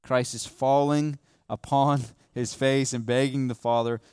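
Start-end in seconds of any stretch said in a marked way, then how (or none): none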